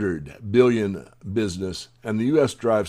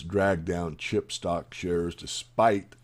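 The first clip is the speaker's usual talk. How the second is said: ordinary delivery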